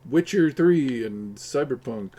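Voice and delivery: ordinary talk, nothing marked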